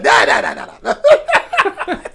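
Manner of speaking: cackling refusal of the rough sound; laughing mock protest. Portuguese guy rejects the German sound, laughing.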